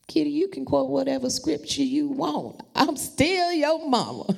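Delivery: a lightly voiced mama setting her straight; mama scolding. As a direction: play the unimpressed mother. Voicing her mother.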